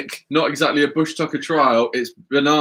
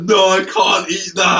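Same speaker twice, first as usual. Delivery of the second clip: disgusted refusal; 4 semitones higher, 3 dB louder than usual. Real, not acted. Refusing the banana.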